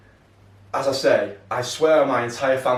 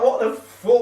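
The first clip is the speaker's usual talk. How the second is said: high, louder, baffled what the fuck; stunned disbelief; 10 semitones higher, 4 dB louder, slower than usual. Retold or imitated. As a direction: react stunned, half laughing. Stunned reaction to compliments.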